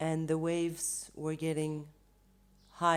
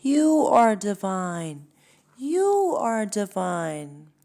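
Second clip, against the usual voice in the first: slow loud echo, a bit higher; louder slow echoed shout; echo yelling back; 4 semitones higher, 11 dB louder, slower than usual. Acted. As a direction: call it back like a crowd echo. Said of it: "all yell back".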